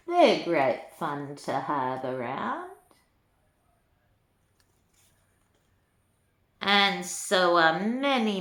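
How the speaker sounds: lilting storybook read-aloud; reading to children. A narrator's reading. Granny reading a rhyming book to children, lilting. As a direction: read the rhymes to little ones with relish.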